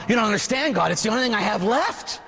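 high-pitched, protesting plea; desperate pleading. Acted. Old man begging God to keep his dirt, desperate. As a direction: plead upward to the last word.

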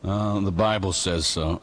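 low, slow, hesitant answer; mock-dopey reply, dragging and low; stumped kid. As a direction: lightly imitate a stumped kid. Imitates stumped kid's weak answer.

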